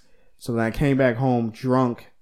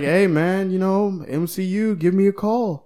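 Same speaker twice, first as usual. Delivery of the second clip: casual bro voice; 7 semitones higher, 4 dB louder, slower than usual. Retold or imitated. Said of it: "He's like".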